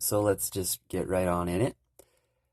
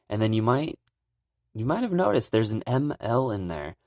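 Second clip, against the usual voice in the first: ordinary talk, nothing marked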